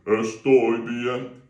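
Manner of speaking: Estoy bien sung timidly, the wrong way. Shown as a demonstration. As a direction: sing it shyly and held back. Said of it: "Don't feel you've got to go".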